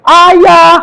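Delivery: high, loud mock baby cry of Ayah; a parent imitating a baby shouting for its father; baby calling Dad. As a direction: call out like a newborn yelling for Dad. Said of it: "kan nggak langsung teriak".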